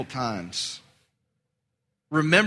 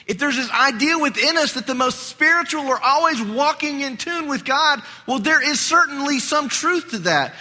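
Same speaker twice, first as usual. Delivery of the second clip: higher, louder, insistent sermon voice; fervent preaching; 9 semitones higher, 4 dB louder than usual. Acted. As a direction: drive the point home from the pulpit. Preacher builds to emphatic point.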